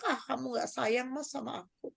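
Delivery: soft, fading pouty wife; sulking wife. Retold or imitated. Wife sulking to husband that he doesn't love her, soft. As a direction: pout the complaint quietly at him. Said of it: "tapi dia bilang".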